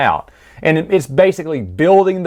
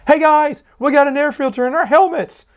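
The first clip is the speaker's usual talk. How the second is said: much higher, eager shout to the squad; excited announcement; 14 semitones higher than usual. Retold or imitated. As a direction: dim clone thrilled by his discovery. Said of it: "He goes".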